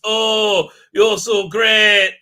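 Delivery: loud, drawn-out fake admiration; hammed-up adoring oh; mock gushing. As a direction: send up fawning praise, slowly. Mocking gushing praise.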